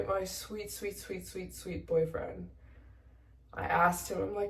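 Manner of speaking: normal talking voice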